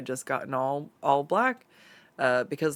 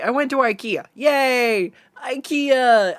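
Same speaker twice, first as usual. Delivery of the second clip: "I went to ikea" again, higher and louder, gushing; excited restart; 8 semitones higher, 6 dB louder, slower than usual. Shown as a demonstration. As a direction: bounce into it like big news. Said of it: "We already went over that part".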